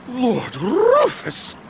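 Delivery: gasp, then a drawn-out stunned exclamation; slow, awed recognition after a gasp. Acted. Officer recognising Lord Rufus, gasping and stunned.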